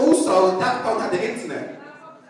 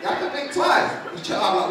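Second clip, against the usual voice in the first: usual speaking voice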